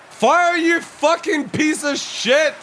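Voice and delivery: high, loud yell at the gun; real furious outburst; furious shout. Real, not acted. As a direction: scream at the broken gun. Gun won't fire.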